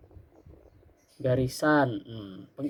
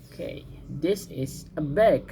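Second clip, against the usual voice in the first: slow model sentence; slower than usual. Shown as a demonstration. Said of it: "Oke, good".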